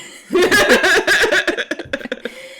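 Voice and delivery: high loud squeaky giggle; squeaky gleeful gremlin giggle. Acted.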